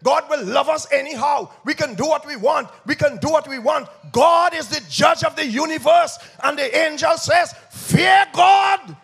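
loud, high, sing-song preaching with rising fire; full force preaching. Acted. Preacher warns the church against cheap grace, shouting. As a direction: thunder it out to the congregation.

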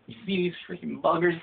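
normal talking voice

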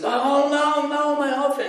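a hammed-up shocked cry over the spoiled offering; dismayed cry. Brahmin sees the child eating his offering, high alarmed wail. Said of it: "And the Brahmin sees it".

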